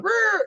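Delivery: sudden high mock growl of the game girl; monster growl. Imitating the monster girl popping out, a sudden growl. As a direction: growl like the creature jumping out.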